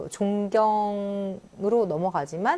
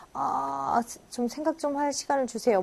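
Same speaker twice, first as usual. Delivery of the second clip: reluctant stalling; 6 semitones higher than usual. Acted. Acting out putting off a suitor.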